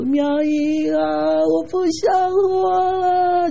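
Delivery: high, very slow, feeble voice of a sick woman; weak dying voice. Retold or imitated. Sick friend in hospital bed, feebly whispering she wants to die. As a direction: drag it out like you have no strength left. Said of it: "真的这种声音啊".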